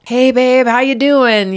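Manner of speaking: bright call down the phone to his wife; husband phoning, upbeat and loud; cheery husband. Retold or imitated. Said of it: "was like".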